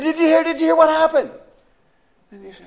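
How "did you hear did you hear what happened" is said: higher and louder, urgent; late-night caller in a panic; panicked caller. Acted. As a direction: blurt it out in alarm. Frantic caller on phone.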